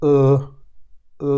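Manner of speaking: ও voiced as rounded ö from deep in the throat; careful umlaut ö demo; German ö sound. Shown as a demonstration. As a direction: say ও as the German ö, tongue pushed forward. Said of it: "ভিতর যে আমাদের যে কণ্ঠ".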